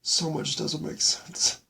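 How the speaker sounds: real tears threatening, halting between phrases; choked up. Real, not acted. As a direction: choke the words out. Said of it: "don't know what I'm going through".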